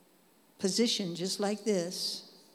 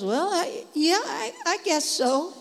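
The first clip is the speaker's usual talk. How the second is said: unsure friend; 8 semitones higher, 3 dB louder than usual. Retold or imitated. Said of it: "And she goes".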